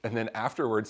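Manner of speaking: ordinary talk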